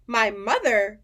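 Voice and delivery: a loud, startled "My mother?"; surprised cry. A narrator's reading. Giant shocked by Sophie's question, crying out. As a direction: blurt it out in disbelief. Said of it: "cried the B F G".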